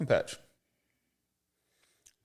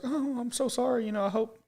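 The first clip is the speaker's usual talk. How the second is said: sympathetic lady; 12 semitones higher than usual. Retold or imitated. Imitating the lady apologizing to a grieving widower. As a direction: offer sorry condolences like a stranger caught out. Voicing the lady's awkward sympathy.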